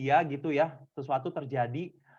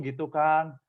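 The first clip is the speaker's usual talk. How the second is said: normal talking voice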